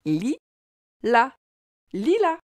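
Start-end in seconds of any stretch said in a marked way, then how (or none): 0.0-1.3 "Li, la" as two separate syllables; split into syllables. Shown as a demonstration. Teacher showing both L sounds to a child, syllable by syllable. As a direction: break lilas into li... la, pausing between. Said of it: "as-tu remarqué que dans lilas".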